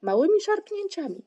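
high, loud coaching call; urging. A narrator's reading. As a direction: call out instructions to spur someone on. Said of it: "zachęcał go Włóczyki".

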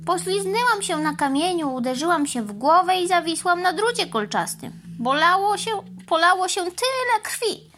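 a sore cow complaining; lightly voiced injured cow; hurt cow. A narrator's reading. Cow tells of accident.

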